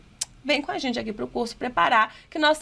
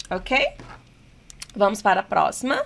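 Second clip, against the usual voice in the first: ordinary talk, nothing marked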